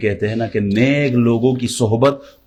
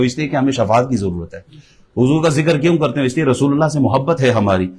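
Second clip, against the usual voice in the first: ordinary talk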